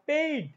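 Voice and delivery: পেইড with a clear e-to-i glide; correct pronunciation. Shown as a demonstration. Teacher tells students to learn it so, gliding পেইড. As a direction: stretch the ei in পেইড. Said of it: "এইভাবে শিখবা".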